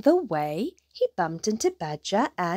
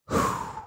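soft airy wind "whoo"; imitated night wind; howling wind; 5 dB quieter than usual. A narrator's reading. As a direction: blow a hushed, hollow gust. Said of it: "Howled the wind".

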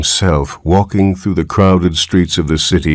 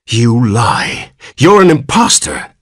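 angry; 5 semitones higher than usual. Acted. Father accusing the old man.

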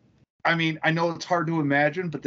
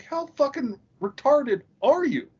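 high-pitched incredulous rebuke; retold exasperation; 8 semitones higher than usual. Fisherman confronts crewmate over misread DVD label, exasperated. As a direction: act out appalled disbelief at someone's mistake.

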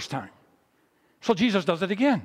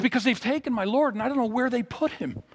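higher-pitched, lightly acted upset; mock tearful; 6 semitones higher than usual. Weeping woman answers the gardener, mildly distressed. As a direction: plead with raised pitch, no real sobs. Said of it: "And she's crying".

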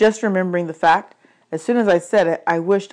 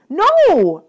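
high, loud denial; protesting; 11 semitones higher, 8 dB louder than usual. Acted. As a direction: cry out in protest. Hero protests the accusation.

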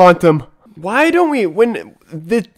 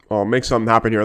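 ordinary talk

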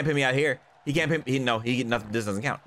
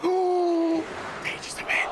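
slow breathy whisper; 7 semitones higher, much slower than usual. Acted. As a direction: whisper it slowly and dramatically. Whispered catchphrase.

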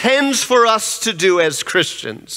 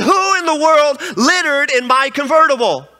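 acted outrage, pitched higher; mock indignation; 6 semitones higher than usual. Lady confronts whoever littered in her car, mock indignation. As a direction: imitate an indignant woman demanding the culprit.

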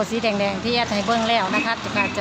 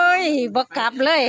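excited exclaim; 5 semitones higher, 4 dB louder than usual. Real, not acted. Amazed the lottery numbers repeat.

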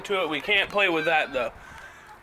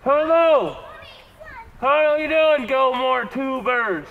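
testing echo; 5 semitones higher, 4 dB louder than usual. Shown as a demonstration. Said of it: "listen how echoey this sounds".

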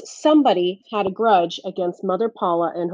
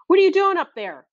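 higher, sharp demanding call; 11 semitones higher than usual. A narrator's reading. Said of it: "Curly demanded".

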